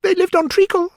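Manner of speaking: very high, loud little creature; Dormouse voice. A narrator's reading. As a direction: squeak it out as a dormouse. Dormouse voice answering Alice.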